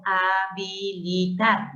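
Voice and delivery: habilitar drawn out syllable by syllable; separated root. Shown as a demonstration. Teacher splitting off the root, slowly spelled out. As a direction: stretch habilitar slowly as a unit of its own. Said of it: "y luego separo".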